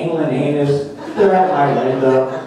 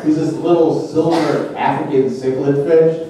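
usual speaking voice

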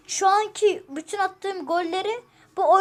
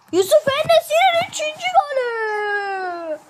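high, loud goal call; commentator goal shout; 8 semitones higher, 6 dB louder than usual. Acted. Commentating his own goal.

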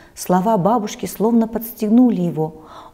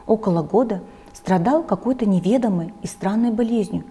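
plain reading voice

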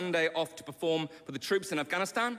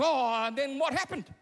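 higher-pitched acted dad asking; concerned dad; 8 semitones higher than usual. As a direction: play the worried father, pitched up. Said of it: "And Mike goes".

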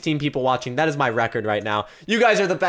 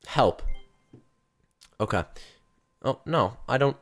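normal talking voice

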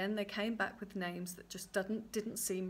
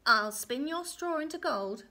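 high, loud character voice; little man's offer; 5 semitones higher, 6 dB louder than usual. Acted. Little man offers the girl a deal, commanding. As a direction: announce it like an imp striking a deal.

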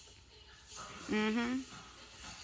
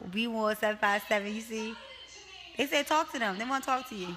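ordinary talk, nothing marked

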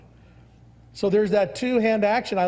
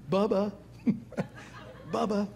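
still small voice; 4 semitones higher, 6 dB quieter than usual. Retold or imitated. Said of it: "in that still small voice".

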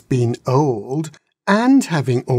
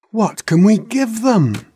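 put-on eager young dragons; 9 semitones higher, 4 dB louder than usual. A narrator's reading. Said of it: "asked the young dragons".

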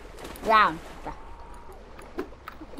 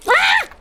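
short high-pitched shriek; startled scream; 5 dB louder than usual. Real, not acted. Player caught off guard in a game, startled scream. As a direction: yelp loudly in sudden shock.

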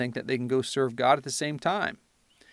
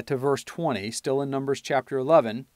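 usual speaking voice

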